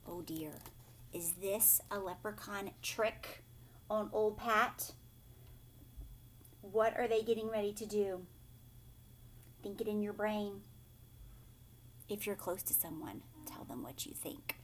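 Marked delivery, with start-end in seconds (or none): none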